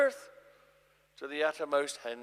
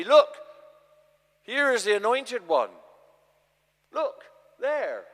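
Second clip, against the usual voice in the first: higher, louder eager cry of a false prophet; false prophet's call; 5 semitones higher, 8 dB louder than usual. Retold or imitated. Jesus mimicking a false prophet announcing the anointed one, eager cry. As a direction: call out like someone pointing at a false messiah. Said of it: "if ever someone to you should say".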